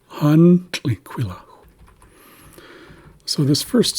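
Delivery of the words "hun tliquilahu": "Hun" high and loud, "tliquilahu" dropping low; high then low tone. Shown as a demonstration. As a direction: slowly model "Hun tliquilahu", high first syllable then falling. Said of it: "at a higher tone".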